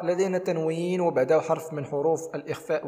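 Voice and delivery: ordinary delivery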